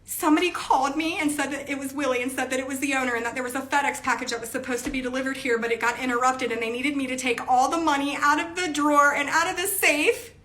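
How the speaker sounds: rising high whine, climbing to a cry; building whine. Retold or imitated. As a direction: tell it climbing into a wail. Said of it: "And she's like".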